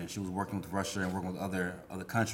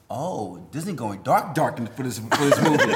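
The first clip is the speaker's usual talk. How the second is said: slow, rising mock gasp over dark Disney; slow laughing mock outrage; mock dramatic; 4 semitones higher, 8 dB louder than usual. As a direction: ham up the shock, laughing through it. Mocking imagined thought about Disney.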